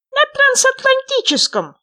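high, loud, squeaky little frog; small creature's high, loud voice. A narrator's reading. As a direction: pipe up eagerly as a tiny frog. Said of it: "сказал лягушонок".

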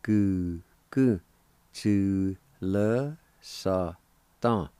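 slow, separated syllables. Shown as a demonstration. Teacher putting both parts together for learners, syllable by syllable. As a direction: sound out the whole phrase slowly, piece by piece. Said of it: "And together".

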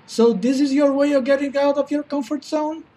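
normal talking voice

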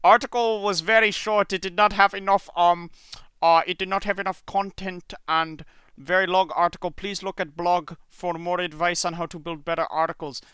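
put-on high, loud Indian accent. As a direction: play the scam commenter, Indian accent. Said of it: "your Indian accent".

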